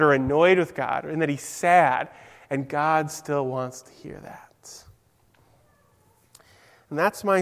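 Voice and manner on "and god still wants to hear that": hushed whisper. Real, not acted. Preacher reassuring the children, hushed whisper. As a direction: whisper it like a secret comfort. Stressing God's closeness to children.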